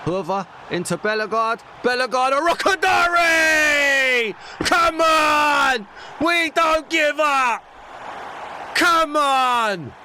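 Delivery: screaming with joy, building to a roar; goal scream. Real, not acted. Gamer roaring at the screen over a late goal, screaming with joy. Goal celebration while playing FIFA.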